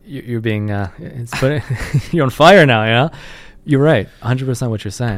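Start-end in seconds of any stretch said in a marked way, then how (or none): 1.9-3.1 laughing. Real, not acted. Host laughs at heated guest.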